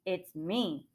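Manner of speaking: soft, steady goat reply; understated, composed reply. A narrator's reading. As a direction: answer the troll quietly and evenly. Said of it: "in a steady voice".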